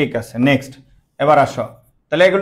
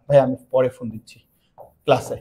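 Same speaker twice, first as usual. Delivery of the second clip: quiet aside; 4 semitones lower, 6 dB quieter than usual. Real, not acted. Aside on the phone.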